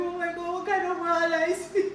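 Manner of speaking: high, loud shout breaking into laughter; laughing panic shout. Retold or imitated. Child overwhelmed by dad's math homework, laughing shout. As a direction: shriek it in mock despair, laughing. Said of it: "you start shouting".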